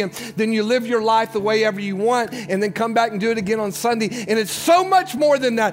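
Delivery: high, loud, driving sermon voice; full-force preaching. Acted. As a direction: push the altar call hard to the congregation.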